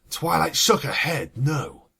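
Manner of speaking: ordinary delivery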